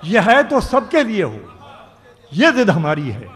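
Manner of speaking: declaiming a ghazal couplet; ghazal recitation. Acted. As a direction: recite each line like poetry on stage. Poet recites verse at mushaira.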